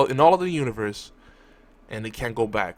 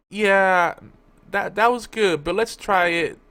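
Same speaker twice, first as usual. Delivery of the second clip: a lightly voiced, higher cheeky Doctor; a hint of the Doctor reading his card; playful Doctor quote; 7 semitones higher, 4 dB louder, slower than usual. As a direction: toss off the line like a smug Time Lord. Doctor's flashcard line, retold.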